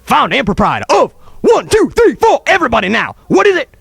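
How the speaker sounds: high, loud call-and-response; hammed-up hype-man shout; showman rallying crowd. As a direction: holler for everybody to answer. Calling for audience response.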